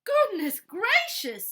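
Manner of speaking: slow, high, loud gasp of surprise; shocked exclamation. Acted. Housekeeper Missus Bird shocked at the bear, loud slow exclamation. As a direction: stretch it out in astonishment. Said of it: "exclaimed Missus Bird".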